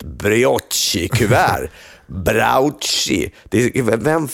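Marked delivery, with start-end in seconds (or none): 0.0-1.7 "brioche-kuvert" drawn out, brioche said bre-atchi; mock mispronunciation. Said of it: "säg så här". 2.1-3.3 "Brauchi" as brao-tchi, mimicking the ad; mock mispronunciation. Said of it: "kan säga det där".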